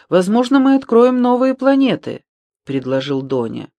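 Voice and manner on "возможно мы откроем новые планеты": high, loud, excited proposal; raised voice, full of hope; eager suggestion. A narrator's reading. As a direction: pipe up with a bright new idea. Donya eagerly proposes finding planets.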